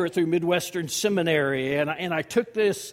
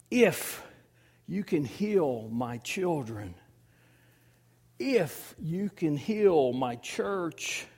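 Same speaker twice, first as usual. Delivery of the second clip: halting prayer. Acted. Voicing a believer's doubting prayer.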